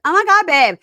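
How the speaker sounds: high, loud, gushing squeal. Retold or imitated. Woman thrilled by a ring from her fiancé, high gush. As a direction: squeal it like she's overwhelmed. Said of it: "And she's like".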